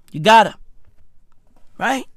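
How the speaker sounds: normal talking voice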